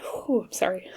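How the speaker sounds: blown-out "ooh" into a teary laugh; tearful apology. Real, not acted. Reader apologising to viewers, tearful and laughing it off. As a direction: apologise while catching your breath from crying. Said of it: "Why are you crying".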